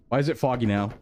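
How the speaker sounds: ordinary talk, nothing marked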